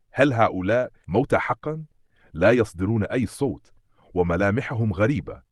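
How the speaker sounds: ordinary delivery, nothing marked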